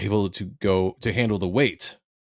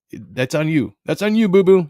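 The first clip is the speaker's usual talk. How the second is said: louder, higher sassy "boo-boo"; sassy teasing; 6 semitones higher, 6 dB louder than usual. Real, not acted. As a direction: tease them with sass.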